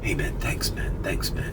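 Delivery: put-on whispered drunk thanks through the window. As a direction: whisper it, leaning in. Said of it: "and he goes".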